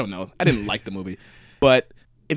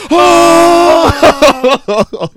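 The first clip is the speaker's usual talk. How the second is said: loud high whooping oh; crowd shout; 10 dB louder than usual. Retold or imitated. Everyone at the party reacting to the burn, loud whoop. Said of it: "And everyone goes".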